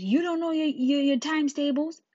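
high, loud scolding question; incredulous teacher. Retold or imitated. Teacher to pupil over times tables, scolding disbelief.

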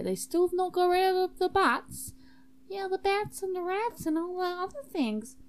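high, loud put-on voice; exasperated student host. [name] explaining the bat problem, high exasperated voice. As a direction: complain in a high character voice.

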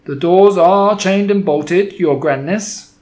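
loud, slow, respectful witch reply; answering witch. Acted. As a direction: report back to her grandness, firmly. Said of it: "answered a voice in the audience".

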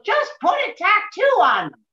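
high, put-on older actress voice; Dodie Goodman voice. Older actress advising her in a high imitation. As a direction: mimic her high, breezy advice. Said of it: "She goes".

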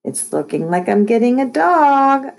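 high, rising singsong, drawing out dog; gloating. A narrator's reading. Kid to upset Lexi about a dog, gloating. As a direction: crow it, building to the last word. Gloating to sister, later cut short.

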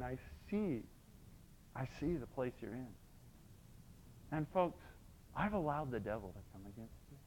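tender voice of God. Retold or imitated. Preacher voicing God comforting the struggling family, softly. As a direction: speak it low and tender, like a loving father.

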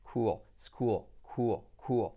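"cool" as KOO-ol; pronunciación correcta. Shown as a demonstration. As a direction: glide "cool" into a dark l, like another syllable. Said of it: "Con esa U bien". ("cool" como «cúol»)

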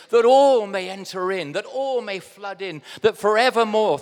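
loud, ringing proclamation; rousing declamation. Real, not acted. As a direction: swell on each ALL like a preacher lifting a hymn. Declaiming hymn words to congregation.

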